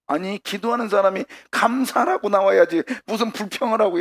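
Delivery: high-pitched, incredulous pushback; acted indignant objection. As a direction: object as if scandalized. Acts out an objection to complaining.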